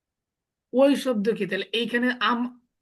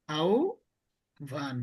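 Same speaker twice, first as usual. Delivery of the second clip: আহ্বান stretched very slowly as "aubhan", the h silent; slow drawn-out demo; 5 semitones lower, 3 dB quieter, much slower than usual. Shown as a demonstration. Teacher to students modelling আহ্বান very slowly as "aubhan". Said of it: "এটার উচ্চারণ কি?".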